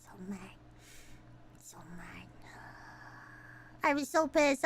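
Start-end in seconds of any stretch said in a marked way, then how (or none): 0.0-2.3 barely audible seething aside; fuming whisper. Real, not acted. Streamer spent after the rant, whispering anger. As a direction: whisper it after a big sigh.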